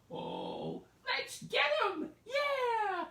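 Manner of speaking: very high, loud battle cry; excited cheer. Acted. Old couple cheering as they chase the gingerbread man. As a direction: squeal off into the chase.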